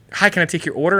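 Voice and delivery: a hint of chirpy counter-service greeting; cashier greeting. Cashier to a customer just walking in, lightly imitated greeting.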